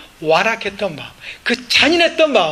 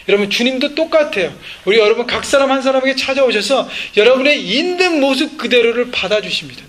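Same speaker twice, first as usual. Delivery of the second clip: normal talking voice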